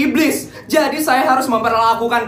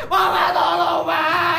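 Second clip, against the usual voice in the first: whiny plea to mama; 6 semitones higher, much slower than usual. Acted. Spoiled kids calling for mama.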